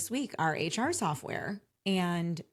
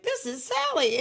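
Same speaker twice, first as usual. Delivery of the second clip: cheery operator; 10 semitones higher, 3 dB louder than usual. Retold or imitated. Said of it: "the phone answers and it's like".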